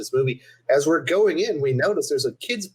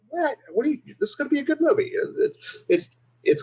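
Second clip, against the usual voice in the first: high, rushed protest; incredulous denial; 13 semitones higher than usual. Retold or imitated. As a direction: blurt it out fast and high, pretending all is fine. Defensive denial to wife.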